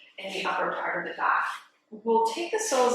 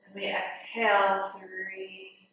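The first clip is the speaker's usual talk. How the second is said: guided meditation. Real, not acted. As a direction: guide them gently into the breath, softening on the counts. Leads breathing meditation.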